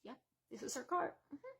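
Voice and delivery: dropped low and soft, knowing; flatly resigned, voice sunk way down; deadpan low mutter. Retold or imitated. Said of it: "we're like".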